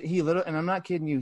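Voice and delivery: usual speaking voice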